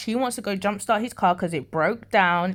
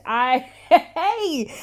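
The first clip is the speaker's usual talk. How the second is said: laughing; 7 semitones higher, 4 dB louder than usual. Real, not acted. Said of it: "A Jamaican came out of me".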